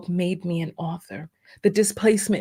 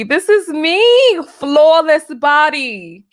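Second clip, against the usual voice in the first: excited; 6 semitones higher, 7 dB louder, slower than usual. Real, not acted. Showing old photo of herself.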